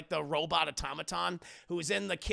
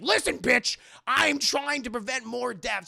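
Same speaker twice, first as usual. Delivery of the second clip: loud, higher retort; acted heated outburst; angry snap; 6 semitones higher, 8 dB louder than usual. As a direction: lash out in exasperation. Acted quarrel, Batman snapping.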